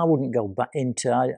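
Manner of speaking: ordinary talk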